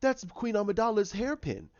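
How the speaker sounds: a fussy droid spotting the clue; C-3PO voice. Retold or imitated. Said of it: "C three P O goes".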